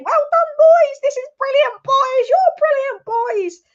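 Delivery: very high, loud cheery cheerleading manager; mock an overjoyed coach praising the lads, way up high; hammed-up delighted squeal of praise; gushing, smiling Oli. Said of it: "Oli be smiling".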